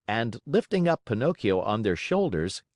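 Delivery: ordinary delivery, nothing marked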